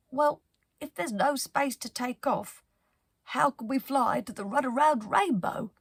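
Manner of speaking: a small crew member with a cold. Acted. A crew member with a cold asks Penelope, a lightly voiced puzzled question. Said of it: "the one with the cold said".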